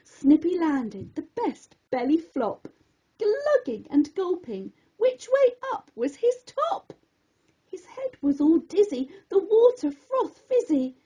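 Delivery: plain reading voice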